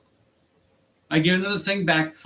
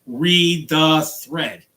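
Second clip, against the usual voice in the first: each word slow, hammered and louder; emphatic; 5 dB louder, much slower than usual. Retold or imitated. Driver reading back his angry text, slow emphatic demand. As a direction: spell it out word by word. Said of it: "typed them back and told them".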